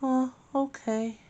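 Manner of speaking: put-on a timid little baby giving in; high baby voice, slowly. Baby agrees to get milk.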